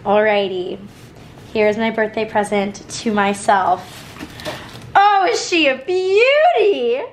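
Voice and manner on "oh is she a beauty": slow, high, gushing delight; excited admiring. Real, not acted. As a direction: swoon over it, drawn out. Admiring the new bag.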